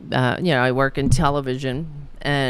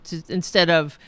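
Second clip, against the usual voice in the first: usual speaking voice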